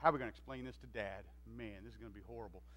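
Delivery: hushed, fast and low, cowering brothers; scared whisper. Retold or imitated. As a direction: mutter it like a frightened kid hiding behind a tent. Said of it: "They're, like, scared".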